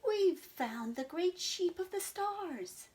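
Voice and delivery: high, slow triumphant sheep; triumphant cry. Acted. Sheep announcing their find to Abigail, triumphant. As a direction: announce it proudly. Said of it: "cried Maisie ... triumphantly".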